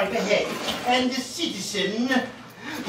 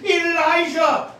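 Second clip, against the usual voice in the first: heavenly call; 8 semitones higher, slower than usual. Acted. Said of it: "a voice out of heaven, calling".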